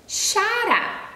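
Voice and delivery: high sing-song "Xará"; bright read-aloud. Shown as a demonstration. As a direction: lift "Xará" to catch kids' ears. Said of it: "onde eu estou lendo".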